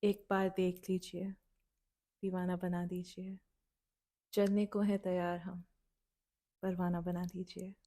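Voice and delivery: very fast, soft, flat poem recital; bored mumbled recital. Shown as a demonstration. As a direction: rattle it off without heart. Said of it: "I'll say it, like, how I would say it".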